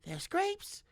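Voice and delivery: high, tender coaxing; gentle to animal. A narrator's reading. Brutha coaxes the tortoise, high and gentle. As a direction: talk to a small creature kindly.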